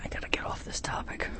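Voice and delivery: quick, very soft whisper; whispered aside. Real, not acted. Host tells himself to drop the topic, whispering. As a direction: whisper it hurriedly to yourself.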